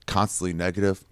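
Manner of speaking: ordinary delivery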